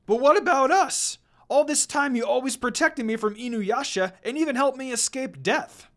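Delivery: loud and high, pleading; wolf pleading with the girl he lost; desperate plea. Acted. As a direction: cry out, hurt and disbelieving. Koga pleads, rejected.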